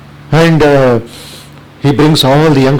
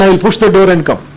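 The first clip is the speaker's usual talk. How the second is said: retold shout; 6 semitones higher than usual. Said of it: "He shouted".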